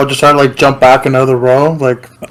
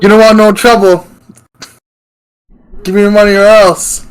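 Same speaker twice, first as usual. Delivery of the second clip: higher, louder robber threat; thug threat; 6 semitones higher, 3 dB louder than usual. Acted. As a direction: push the threat like a cocky street thug.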